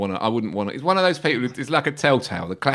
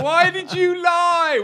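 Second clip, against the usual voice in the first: mock plea; much slower than usual. Mock plea to David Moyes.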